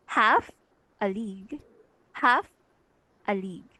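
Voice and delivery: strong-weak-weak beat on "half a league"; dactyl stress. Shown as a demonstration. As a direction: hit "half", pause, then let "a league" fall away. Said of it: "the first one is stressed".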